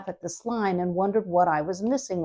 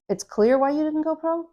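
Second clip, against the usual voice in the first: sarcastic tease; 8 semitones higher than usual. Retold or imitated. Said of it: "written to be sarcastic and be a little crap-giving".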